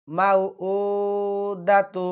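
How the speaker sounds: মাউউদাতু with the উ drawn out one beat, slowly; one alif stretch. Shown as a demonstration. Teacher showing the one-alif stretch. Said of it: "এক আলিফ টানতে হবে".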